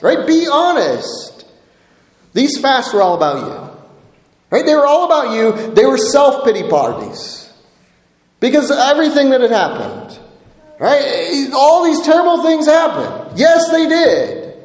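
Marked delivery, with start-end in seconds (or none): 0.3-1.4 higher, slow and deliberate "Be honest"; slow insistence. Shown as a demonstration. 11.5-14.5 higher, raised preaching voice; preaching shout. Real, not acted. Preacher driving the point home.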